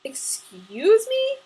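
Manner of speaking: drawn-out, high, loud indignation; appalled. A narrator's reading. Woman just accused by her neighbor, appalled. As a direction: stretch it out, soaring into an offended question. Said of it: "appalled, and glared at him".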